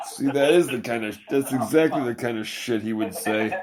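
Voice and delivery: ordinary talk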